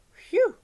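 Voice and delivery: loud relieved exhale after nearly drowning; big relieved phew. Acted. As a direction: blow out a huge phew of relief. Relief after escaping the stream.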